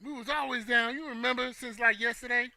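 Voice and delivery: a hint of a fawning friend; mock fake friend. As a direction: pretend you were always close.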